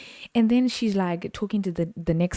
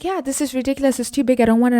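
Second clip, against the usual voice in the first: lightly put-on higher voice, fussy; complaining customer; 7 semitones higher, faster than usual. Customer rejecting the candy, mock fussy. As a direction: imitate a dismissive complainer, pitched up.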